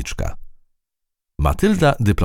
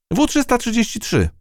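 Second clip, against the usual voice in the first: high ant voice, at an ordinary volume; correcting. A narrator's reading. Ant corrects mouse on its name, high-voiced. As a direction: set the number straight, pitched high. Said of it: "poprawiła ją mrówka".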